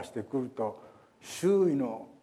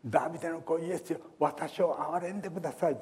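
high, fast cry for mercy; a blind man shouting to Jesus, high pleading cry; 7 semitones higher than usual. Retold or imitated. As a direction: cry out to be heard over the crowd. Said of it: "と大声で叫び続けた".

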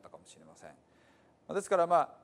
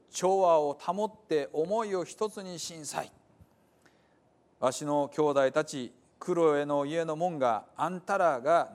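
slow, louder stern warning; stern rebuke; 2 semitones higher, 5 dB louder, slower than usual. Acted. Paul sternly rebuking the divided church, slow and louder. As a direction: lay down the law to quarreling believers. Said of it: "きびしゅう言うね".

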